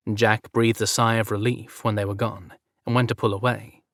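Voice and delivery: ordinary delivery, nothing marked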